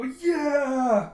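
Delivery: high, loud, drawn-out whoop; mock crowd cheer. Villagers cheering for booze, loud whoop.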